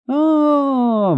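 very high drawn-out cry; sudden high-pitched exclamation; cheeky shriek. A narrator's reading. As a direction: burst in with an insolent yell. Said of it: "wrzasnął nagle znajomy, bezczelny głos".

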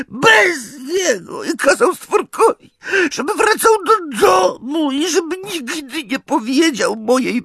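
very high, wailing elf voice; sobbing house-elf. A narrator's reading. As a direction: wail it, squeaky and desperate. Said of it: "Zaniósł się takim szlochem".

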